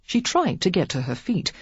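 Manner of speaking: usual speaking voice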